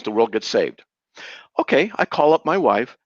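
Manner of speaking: usual speaking voice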